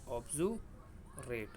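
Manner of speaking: ऑब्जुरेट drawn out slowly and softly; ऑब्जुरेट stretched out syllable by syllable; slow model word. Shown as a demonstration. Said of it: "ऑब्जुरेट होता है".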